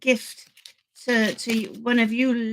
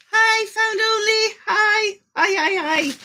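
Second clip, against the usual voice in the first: excited greeting; 9 semitones higher, 4 dB louder than usual. Real, not acted. Greeting a viewer by name.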